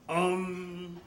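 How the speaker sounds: long, stalled hesitation; imitated dumbfounded hesitation; Hodor. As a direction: draw out the sound as a dim lug stumped for an answer. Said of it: "And Hodor goes".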